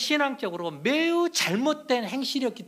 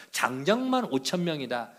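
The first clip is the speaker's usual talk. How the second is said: normal talking voice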